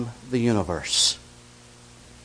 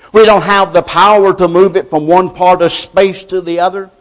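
loud, driving preacher's cadence; full-force preaching; 6 semitones higher, 13 dB louder than usual. Acted. Preacher to his congregation, booming sermon delivery.

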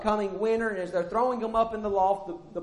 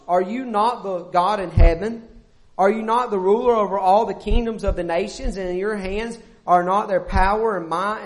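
rising, louder preaching read; loud scripture reading; 5 dB louder than usual. Acted. Pastor reading the prayer of Second Chronicles to the congregation, proclaiming it. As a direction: proclaim the verse to the church.

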